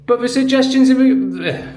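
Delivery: very high, loud hysterical mimicry; shrieking mock commenter. Mocking Sarah's outraged comment, high and shrieking. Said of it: "And then Sarah's like".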